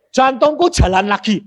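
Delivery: high, loud excitement; amazed announcement. Retold or imitated. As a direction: cry out the miracle to your wife. Announces heart beats after reviving. (seruan takjub, tinggi dan lantang)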